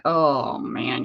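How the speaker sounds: a put-upon dog grumbling in a low moan; dog's moan. Retold or imitated. Voicing the dog moaning to Mom in a low groan. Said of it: "hear him moan like".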